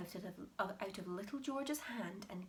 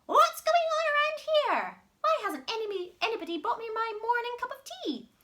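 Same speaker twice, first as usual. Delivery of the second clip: put-on much higher and louder old hag; Grandma shouting; 13 semitones higher, 11 dB louder than usual. Grandma shouting at the family about tea. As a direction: bellow like a furious old witch. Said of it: "she shouted".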